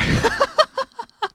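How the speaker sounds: high-pitched burst of laughter; high squealing laugh. Real, not acted. End of cheerful interview.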